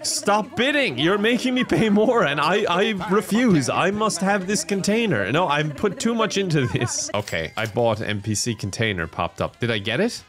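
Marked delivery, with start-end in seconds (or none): 0.0-7.1 frantic bidding. Real, not acted. Frantic bidding war at auction.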